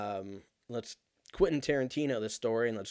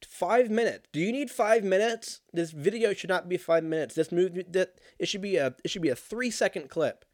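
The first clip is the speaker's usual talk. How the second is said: exasperated; 7 semitones higher, 5 dB louder than usual. Real, not acted. Annoyed at long video.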